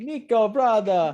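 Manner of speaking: high-pitched, badly faked European accent; Roman. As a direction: call your cousin in a shaky-attempt European accent. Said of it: "I can't do that European accent".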